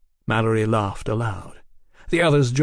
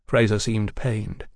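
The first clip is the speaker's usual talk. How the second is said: ordinary delivery, nothing marked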